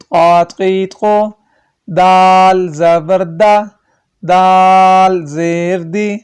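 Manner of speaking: slow, drawn-out chant of each letter and vowel; qaida recitation. Shown as a demonstration. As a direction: recite the drill, stretching every syllable.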